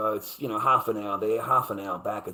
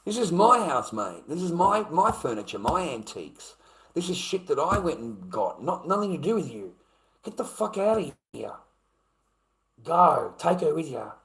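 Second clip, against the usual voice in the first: heated, higher-pitched possessive rant; angry; 6 semitones higher than usual. Retold or imitated. Man claiming his house and furniture, angry. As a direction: stake your claim indignantly, then order them out. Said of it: "I was so fucking angry".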